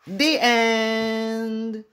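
put-on cheerful announcement to the kids that the story is over; storybook ending. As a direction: close the book with a flourish. Closing the picture book.

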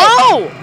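high, loud startled "oh"; sudden high cry of shock; startled scream. Real, not acted. Player hit by a jump scare, startled scream.

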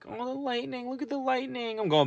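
high, soft whiny put-on voice; lightly voiced excuse-maker whining; mock whiny voice.

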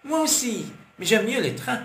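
lightly voiced eager child; little boy. A narrator's reading. Child Tidji answering Demetra.

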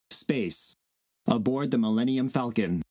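normal talking voice

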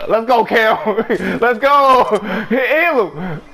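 cheering. Real, not acted. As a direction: hype up a teammate. Cheering teammate on.

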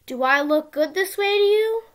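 ordinary delivery, nothing marked

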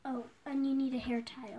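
ordinary delivery

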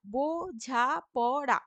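বোঝাপড়া drawn out slowly, beat by beat; slow teacher's split of বোঝাপড়া; syllable by syllable. Shown as a demonstration. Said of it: "বো মুক্ত দল".

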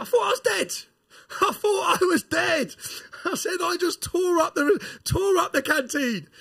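high, slow, breathless laughing. Retold or imitated. Back at his cell window after the fight, laughing high and slow. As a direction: laugh it out in disbelief and relief. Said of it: "I'm laughing".

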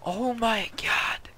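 breathless slow whisper of amazement; whispered awe. Real, not acted. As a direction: whisper it in disbelief. Whispered amazement after gasp.

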